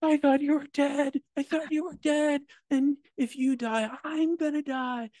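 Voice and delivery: very high, strained wail at normal volume; imitated tearful cry. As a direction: act out a distraught friend crying with relief. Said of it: "and he's crying".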